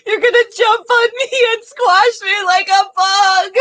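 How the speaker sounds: hysterical high-pitched wail; hammed-up shriek of panic; Leo Bloom. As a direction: wail it at the top of the voice range. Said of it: "who is hysterical because".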